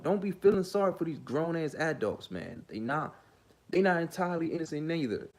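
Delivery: ordinary talk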